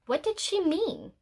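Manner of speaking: ordinary delivery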